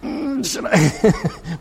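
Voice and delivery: high, drawn-out hesitant hem; hammed-up squeamish hesitation, very slow; hesitant reluctance. Anyone asked to touch a leper, hammed-up hesitation. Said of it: "any one of us would be like".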